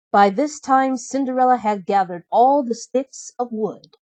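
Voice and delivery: ordinary delivery, nothing marked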